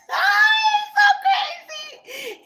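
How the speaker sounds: very high, loud squeal; laughing shriek. Real, not acted. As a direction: shriek it out, cracking up. Cracks up at her own impression.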